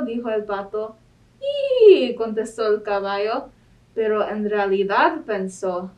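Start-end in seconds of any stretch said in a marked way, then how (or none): 1.4-2.2 a very high, loud "¡Ih!"; whinny it sharply; horse whinny. Acted. Horse answering Duck with a high loud whinny. Animal noises in picture book.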